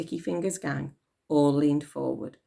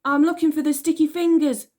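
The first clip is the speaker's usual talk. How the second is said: put-on high loud girl's call; 12 semitones higher, 7 dB louder than usual. A narrator's reading. Elsie searching for the gang, calling out high. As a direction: call out bravely into the dark.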